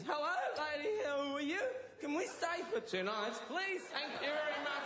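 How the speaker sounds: very high, silly tourist voice; mock badly intoned foreign English; foreigner as heard. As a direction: squeal it like a clueless tourist. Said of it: "they probably is".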